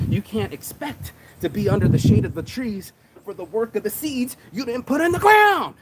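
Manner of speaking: building to a high, loud shout; fired up. Real, not acted. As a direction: preach it hard and climb into a yell. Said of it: "I'm fired up this morning".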